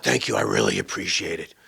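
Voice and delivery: mock-effusive thank you; overdone inflection. Shown as a demonstration. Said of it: "Thank you with some inflection. Not".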